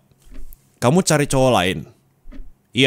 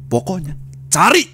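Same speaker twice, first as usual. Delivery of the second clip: angry order; 5 semitones higher than usual. Acted. Acted angry order to search.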